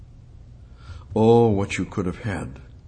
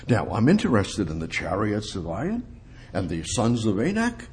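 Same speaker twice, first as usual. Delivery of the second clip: ordinary talk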